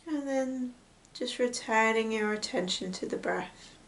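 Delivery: hushed, unhurried meditation voice with long pauses; guided meditation. Real, not acted. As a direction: gently guide them into noticing the breath. Leads a breath meditation.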